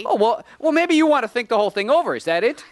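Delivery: fast, high, loud retort; heated, rapid-fire outburst; angry challenge. Acted. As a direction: fire it back in a rush. Man escalates the quarrel.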